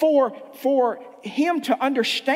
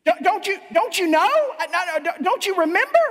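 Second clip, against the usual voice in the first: frantic, higher and louder plea; desperate begging; 8 semitones higher, 4 dB louder than usual. Acted. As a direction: beg Jesus not to turn you away, panicking. Rejected sinner begging Jesus.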